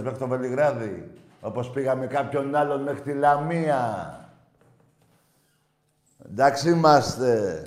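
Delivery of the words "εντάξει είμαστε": Εντάξει είμαστε slow and loud, stressing Εντάξει. Real, not acted. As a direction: declare Εντάξει είμαστε firmly. Said twice, loud then soft.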